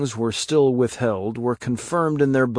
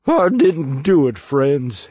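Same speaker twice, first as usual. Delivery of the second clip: high, loud sobbing plea; accused man crying his innocence; 3 semitones higher, 5 dB louder, slower than usual. Acted. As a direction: beg them to believe you through tears. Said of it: "he sobbed".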